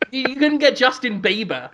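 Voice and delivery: ordinary talk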